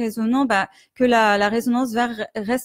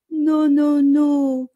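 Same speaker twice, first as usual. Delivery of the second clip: timid whimpering no; 3 semitones higher, much slower than usual. Shown as a demonstration. Coach demonstrating a timid little-boy no. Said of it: "Des petits sons comme ça, un petit peu timides, un petit peu gémissants".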